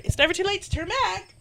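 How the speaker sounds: high-pitched, reluctant hiker voice; timid player character. Acted. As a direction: pitch it up, wanting to back out. Redoes line in player character's voice.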